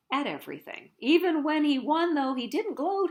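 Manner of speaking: ordinary delivery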